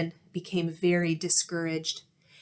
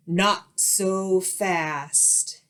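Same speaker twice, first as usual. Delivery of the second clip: unpleasant hiss; much slower than usual. A narrator's reading. Strict substitute hissing at misbehaving kids, menacing. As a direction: stop them cold like a witch. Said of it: "hissed an unpleasant voice".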